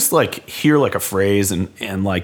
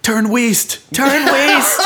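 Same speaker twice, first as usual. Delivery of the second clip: mock GPS voice; 3 dB louder, much slower than usual. Joking GPS style command.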